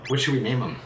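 normal talking voice

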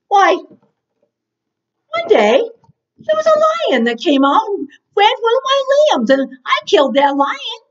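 put-on high, animated boasting of young David; bold insistence. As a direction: plead your case eagerly, pitch pushed up. Acting David insisting to Saul.

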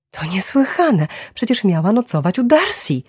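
outraged outburst, pitch rising high; indignant exclamation. A narrator's reading. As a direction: exclaim it in shocked protest. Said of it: "wykrzyknęła".

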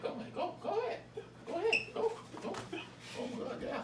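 squealing laughter, egging them on; laughing excitedly. Real, not acted. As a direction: crack up while urging them on. Laughing as helper overpours.

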